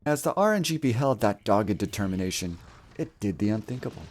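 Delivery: grand, measured fairy-tale narrator; epic story narration. Acted. Streamer narrating his game run as legend. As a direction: tell it like an ancient legend.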